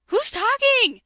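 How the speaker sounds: normal talking voice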